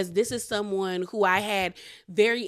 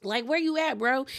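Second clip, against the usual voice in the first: raised voice calling for a friend; 7 semitones higher than usual. Retold or imitated. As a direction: shout across the room, bro style.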